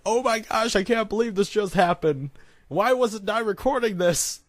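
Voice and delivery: high-pitched excited gushing; giddy disbelief. Retold or imitated. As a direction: squeal it in amazed excitement. Retelling hysterical excitement with friend.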